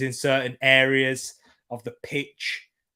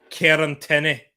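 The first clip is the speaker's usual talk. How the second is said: a name in put-on Scottish, louder; Scottish accent; 5 dB louder than usual. Shown as a demonstration. Streamer naming a Scottish player, attempted Scottish accent. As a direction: punch out a rough Scottish accent. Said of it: "I'm mixing Glaswegian and Ed".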